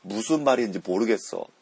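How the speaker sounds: 무슨 말인지 모르겠어 fast and loud, each m softened toward b; natural Korean pronunciation. Shown as a demonstration. Teacher repeating the model sentence at speed. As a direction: say 무슨 말인지 모르겠어 the way natives do, m almost b. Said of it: "こういう感じで発音します".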